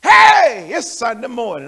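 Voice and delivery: loud, high, thrilled "Hey"; excited greeting. Acted. Preacher acting out churchgoers excited about God, thrilled shout. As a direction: shout it like a starstruck fan. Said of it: "had that excitement about the presence of God".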